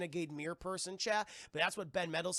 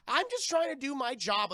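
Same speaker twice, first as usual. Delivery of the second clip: mock meek henchman; 8 semitones higher, 6 dB louder than usual. Nicer henchman voiced.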